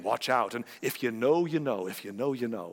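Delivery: mock ominous, low and hushed; a hammed-up hint of menace in "if you know, you know". Preacher mocking cryptic theorists, saying it ominously. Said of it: "they say things ominously".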